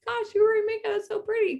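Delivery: high, gushing compliment on the makeup; gushing fan voice. Retold or imitated. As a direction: squeal it like an excited friend. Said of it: "you're always like".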